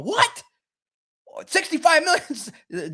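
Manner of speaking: high squeaky mock shock; shocked disbelief. Doc hearing the contract offer, high mock disbelief. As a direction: act floored by the number. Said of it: "Doc will be the only one going".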